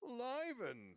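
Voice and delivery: loud, high exclamation; jovial fake astonishment. A narrator's reading. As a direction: boom it out in mock surprise. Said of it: "said Sir Patrick".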